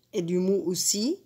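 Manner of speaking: ordinary talk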